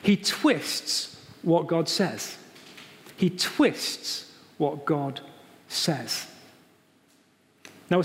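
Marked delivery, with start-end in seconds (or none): 0.0-2.4 slow emphasis. Shown as a demonstration. Preacher repeats point slowly. 3.2-6.3 slow emphasis. Shown as a demonstration. Preacher repeats point slowly.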